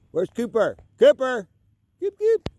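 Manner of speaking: high, loud calls rising to a squeal; urgent calls into the distance; calling the dog. Real, not acted. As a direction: shout for him, then a pet-name squeal. Calling lost dogs outdoors.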